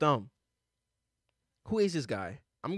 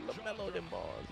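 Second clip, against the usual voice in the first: much softer, tossed-off echo; quiet repeat; 14 dB quieter than usual. Real, not acted. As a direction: mumble it back to yourself. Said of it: "I just said that".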